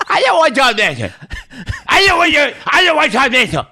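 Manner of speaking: put-on high, loud, indignant old lady; grandma voice. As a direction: refuse firmly as the grandmother. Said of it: "and she's like".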